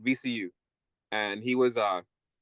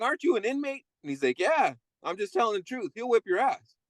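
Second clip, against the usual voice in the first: much higher, playful inmate voice; amused inmate voice; 9 semitones higher than usual. Retold or imitated. Old inmate answers the new one's taunt: high amused voice. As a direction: pitch it up and play the wisecracking con. Inmates arguing about the fight.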